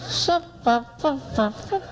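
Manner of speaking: high tiny peeps, one by one. Retold or imitated. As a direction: squeak out little separate syllables. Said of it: "a girl with a soft voice".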